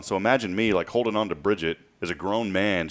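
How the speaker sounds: ordinary talk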